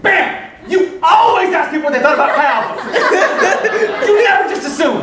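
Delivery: high, loud comic gloating; triumphant shout. Retold or imitated. Crowing at Will after the conversion.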